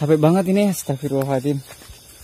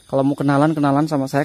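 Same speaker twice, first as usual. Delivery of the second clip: normal talking voice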